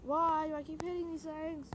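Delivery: ordinary delivery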